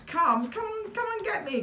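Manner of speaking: high, teasing dare; taunting. A narrator's reading. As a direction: goad the fox to chase you. Mole taunts the fox.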